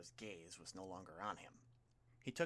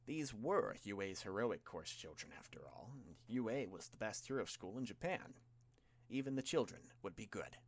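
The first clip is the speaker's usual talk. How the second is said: plain reading voice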